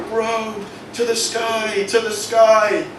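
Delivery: high, slow chant of cheering little nettles; nettle chorus. Acted. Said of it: "all his other nettle buddies are going".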